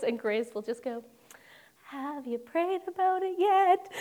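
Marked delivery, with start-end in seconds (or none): 1.8-3.9 lightly voiced sweet mom, higher; a hint of a sing-song mother; mom's sweet question. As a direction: mimic mom's gentle pious nudge. Mom's original line.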